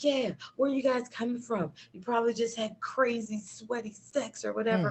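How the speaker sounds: upset. Retold or imitated. Side chick questions the pair on arrival, mock upset. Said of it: "she's so upset".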